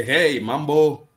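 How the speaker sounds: loud, high, drawn-out hello; hearty greeting. Acted. Walking in and greeting locals, hearty call.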